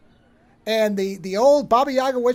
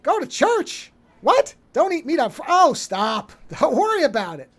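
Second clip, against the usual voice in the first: high-pitched incredulous questions, then brushing it off; acted scoffing disbelief, pitched up; mock incredulous devil; 5 semitones higher than usual. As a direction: lightly play a devil balking at church rules. Acting out devil dismissing church rules.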